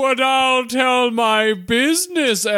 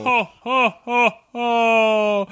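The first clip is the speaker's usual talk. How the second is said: jolly ho ho ho. Acted. Santa host ho ho ho.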